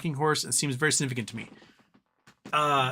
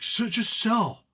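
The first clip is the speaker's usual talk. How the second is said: mock advice; 6 semitones higher than usual. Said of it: "Other than, like".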